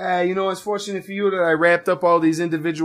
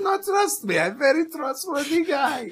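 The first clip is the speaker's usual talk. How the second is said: mock offended dentist; 8 semitones higher than usual. Dentist reassuring distrustful patient.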